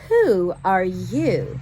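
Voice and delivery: slow, loud, haughty "Who are you?"; grand voice. Acted. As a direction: draw it out like a lofty little lady. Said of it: "maybe I should try that again".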